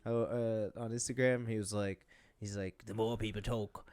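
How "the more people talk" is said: put-on soft, botched Irish accent; terrible Irish accent. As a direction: attempt a bad Irish brogue, quietly. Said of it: "my Irish is terrible. My Irish accent".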